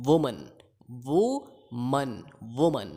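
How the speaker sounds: वुमन low, as WU-mun; short unstressed "mun" ending in वुमन; correct pronunciation. Shown as a demonstration. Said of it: "next word है".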